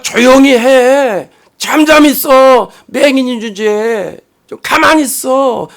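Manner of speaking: slow, drawn-out hushing rebuke; stretched-out mock scolding; acted scolding. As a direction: imitate a crowd telling off a beggar. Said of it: "막 꾸짖습니다".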